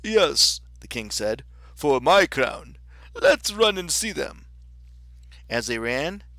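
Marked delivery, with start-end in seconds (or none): 0.0-0.6 loud, higher storybook king. Acted. 1.7-4.5 loud, higher storybook king. Acted.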